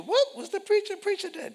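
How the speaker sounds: quick, high questioning voice; curious churchgoer. Pretend. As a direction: ask fast, pitch up like a curious friend. Congregant asked about the sermon.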